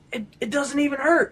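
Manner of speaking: high, amazed and tearful; overwhelmed with disbelief and joy. Retold or imitated. Healed friend crying with joy.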